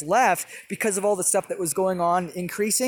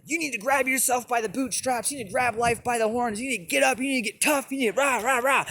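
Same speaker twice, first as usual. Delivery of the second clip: higher-pitched cowboy pep talk; 5 semitones higher than usual. Retold or imitated. Inner cowboy urging him to toughen up, cowboy voice. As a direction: put on a high, rousing cowboy voice. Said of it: "this cowboy voice started coming through".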